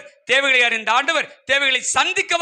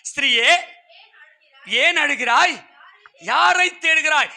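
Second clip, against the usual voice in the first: proclaimed question; 2 semitones higher than usual. Acted. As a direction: call the question out to the congregation, slowly. Preacher voices Jesus' question to Mary. (உரக்க, நிறுத்தி நிதானமாகக் கேட்பது)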